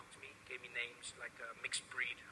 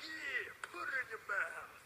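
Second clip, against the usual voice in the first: higher, slow cocky American slang; swaggering put-on American; 8 semitones higher, much slower than usual. [name] rehearsing on the plane, slow cocky American swagger. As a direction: lay the swagger on thick.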